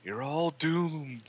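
slow ominous raspy drawl; Crazy Ralph voice. Retold or imitated. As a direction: drag out a doomsday warning. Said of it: "Crazy Ralph".

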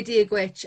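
"Díobh Ghuit" said as dee-ug gwitch; Irish greeting. Shown as a demonstration. Irish guest teaching the host a greeting, careful Irish. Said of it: "I would say".

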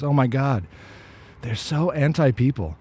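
normal talking voice

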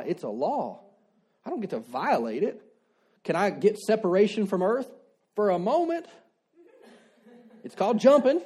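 usual speaking voice

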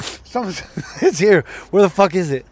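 half-laughing loud retold panic; frantic searching. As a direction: bark it out while sniffing around the house. Hunting for hidden plants.

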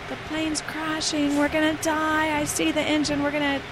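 high, frantic mock panic; panicked screaming. Passenger screams in panic on the plane, imitated high. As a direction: cry out that the plane is going down. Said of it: "she started screaming".

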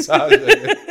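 high squeal while laughing. Real, not acted. Echoing punchline with laughter.

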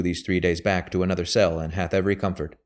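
plain reading voice

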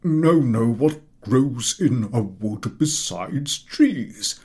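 loud, slow no, no; impatient correction. A narrator's reading. Professor brushing off a wrong answer. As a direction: correct him sharply.

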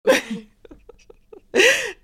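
big burst of laughter; amused by friend's joke, loud high laughter. Real, not acted.

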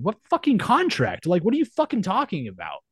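incredulous retold shout. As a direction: blow up in disbelief at the contract. Retold outrage at the merch cut.

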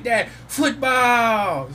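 put-on high, loud, very slow little-kid shout; toddler shouting. Daughter repeating her demand to dad, shouted high. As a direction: stretch it out like an eager toddler. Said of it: "And she's like".

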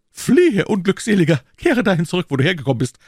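loud, high banishing cry, easing off at the end; declaimed, exuberant order; theatrical command. Acted. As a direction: send the servant fleeing with mock-tragic grandeur. Exultant Aramis banishes servant with food.

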